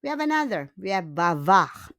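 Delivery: ordinary talk, nothing marked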